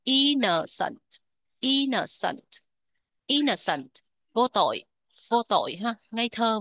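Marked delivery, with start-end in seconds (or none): 0.0-3.9 "Innocent" with IN punched, the rest falling away; first-syllable stress. Shown as a demonstration. Teacher repeating it for learners, stress on IN in "Innocent". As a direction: stress the opening of "Innocent": IN-no-cent. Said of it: "nhấn âm một các bạn".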